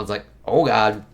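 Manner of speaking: ordinary talk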